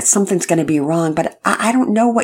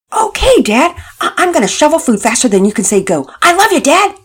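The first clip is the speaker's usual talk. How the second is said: much louder and higher, shouting with joy; yelled; 11 semitones higher, 8 dB louder than usual. A narrator's reading. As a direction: yell it happily down the phone. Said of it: "Todd yelled".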